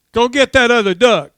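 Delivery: loud, high-pitched acted order; full-voiced call across the water; shouted dog command.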